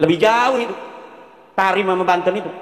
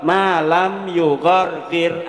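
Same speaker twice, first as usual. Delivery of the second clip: slow recital; much slower than usual. Shown as a demonstration.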